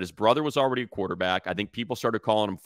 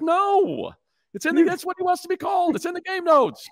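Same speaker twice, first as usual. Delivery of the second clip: retold protest; 5 dB louder than usual. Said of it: "I'm like".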